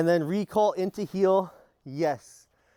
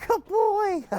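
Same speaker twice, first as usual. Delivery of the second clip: excited praise; 3 dB louder than usual. Real, not acted. Praising dog at session end.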